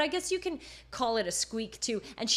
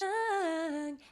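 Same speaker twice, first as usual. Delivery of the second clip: breathy head voice; 6 semitones higher than usual. Shown as a demonstration. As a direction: hang sung high and airy. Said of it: "pretty breathy".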